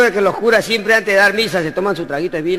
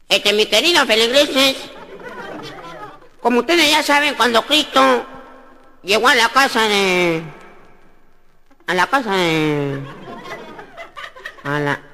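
mock sermon; 4 semitones higher than usual. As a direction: bluff through a sermon. Sacristan preaching from pulpit. (sermón torpe y pausado)

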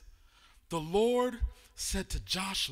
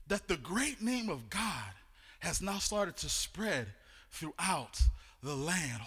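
usual speaking voice

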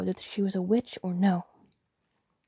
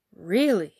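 loud, amazed exclamation; astonished; 8 dB louder than usual. Acted. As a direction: burst out in loud surprise. Mother amazed by daughter's tale.

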